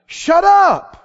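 high, forceful shouted command; out loud. Shown as a demonstration. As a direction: shout down the enemy's thoughts. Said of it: "just speak right out loud and go".

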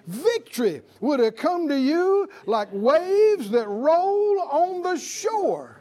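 slow, building preacher's swell; rising sermon crescendo; preaching at full force. Real, not acted. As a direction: stretch each phrase and climb to a ringing close.

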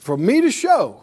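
=call it out to be echoed; out-loud declaration. Real, not acted. Preacher leads congregation in a confession, out-loud declaration. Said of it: "Say it out loud".

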